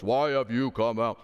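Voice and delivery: slow, heavy put-on deep voice; hammed-up giant's voice, slowly. Shown as a demonstration. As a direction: draw it out in a mock deep voice. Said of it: "put on a deep voice".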